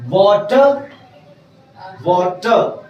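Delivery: वाटर ending in an open "uh", R dropped; silent R. Shown as a demonstration. Teacher shows how some people read it, वाटर with silent R. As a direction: say वाटर as "waa-tuh". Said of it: "आर को silent कर देंगे".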